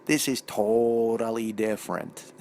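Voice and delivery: slow, low imitation of a Wisconsin wife; Wisconsin accent. Wife to husband about the sandwich, drawn-out Wisconsin accent. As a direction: draw out "totally" with Wisconsin vowels. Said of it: "in her Wisconsin accent".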